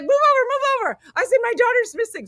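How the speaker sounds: high, urgent retold shouting; freaking out. Mom to packed elevator about missing daughter, frantic plea. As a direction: push through a crowd with your voice. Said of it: "I'm freaking out".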